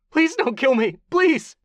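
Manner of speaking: very high, loud shrieking plea; high panicked wail; desperate begging. Acted. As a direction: scream for mercy. Captive begging for life.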